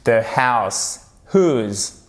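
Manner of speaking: slow The house whose, with whose said as "hooz"; careful teacher model of whose; no W sound. Shown as a demonstration. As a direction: drop the W in whose and say it slowly. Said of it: "prononce pas le W".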